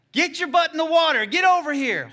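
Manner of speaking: high, very loud re-enacted yell; shouted summons. Preacher ordering the boy into the water, shouting. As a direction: holler up at him to come over.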